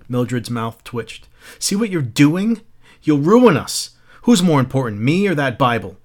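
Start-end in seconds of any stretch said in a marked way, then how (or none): 1.6-6.0 higher, louder panicked shriek. Acted. Wife shrieking at Montag over the Bible. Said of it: "She was beginning to shriek now".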